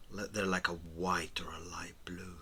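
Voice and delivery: ordinary talk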